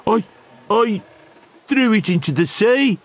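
high-pitched, halting troll voice; nervous troll. Acted. Troll confesses to pirate chief.

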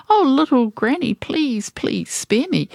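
high-pitched pretend begging; sneering mock plea. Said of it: "to answer with a nasty sneer".

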